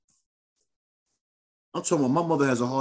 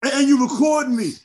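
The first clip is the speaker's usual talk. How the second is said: high, loud indignant comeback; mock outraged father; 13 semitones higher, 8 dB louder than usual. Father accusing son of recording him, mock outrage. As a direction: throw the accusation back, raised and heated.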